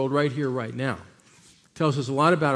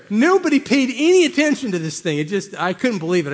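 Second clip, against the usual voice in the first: louder, higher disbelief; incredulous; 6 semitones higher, 8 dB louder than usual. Real, not acted. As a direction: exclaim it in disbelief. Exclaiming in disbelief.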